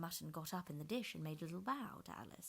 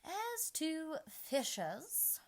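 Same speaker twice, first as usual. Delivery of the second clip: high, very slow solemn queen; slowly and solemnly; 11 semitones higher, 4 dB louder, much slower than usual. A narrator's reading. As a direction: announce it with grave weight. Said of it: "very slowly and solemnly".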